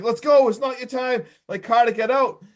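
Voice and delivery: half-shouted plea, imitating panicked friends; retold yelling. Friends to Carter stuck in the car, retold yelling. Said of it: "And they're yelling".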